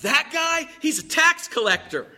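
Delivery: high, brisk, boastful prayer; self-righteous Pharisee. Acted. Pharisee boasts to God about himself, self-righteous. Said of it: "And one guy prays".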